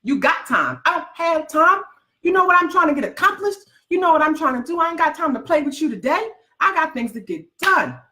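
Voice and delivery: high, put-upon voice of people who think you're free; mocking the doubters. Mocking people who think entrepreneurs have free time, in a put-upon voice. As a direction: imitate a nine-to-five critic, hammed up. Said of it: "because to them".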